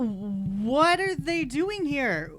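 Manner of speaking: ordinary delivery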